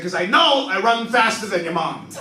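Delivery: loud smug dad boast; cocky dad. Retold or imitated. Dad bragging to son, loud and smug. Dad's punchline about bear.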